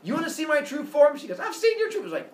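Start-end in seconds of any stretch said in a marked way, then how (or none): 0.0-1.2 loud, raised-pitch ultimatum; acted exasperated outburst; fed-up challenge. Zeus snaps in acted quarrel. 1.4-2.0 high, loud comeback; acted high-pitched anger; yelled retort. She yells back at Zeus.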